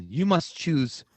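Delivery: slow, far-off grave pronouncement, last word sinking very low; mock oracle. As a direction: hand down the choice like a solemn movie sage.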